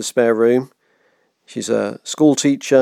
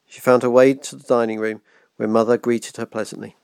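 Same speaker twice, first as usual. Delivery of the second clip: usual speaking voice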